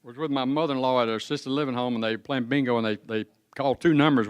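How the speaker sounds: normal talking voice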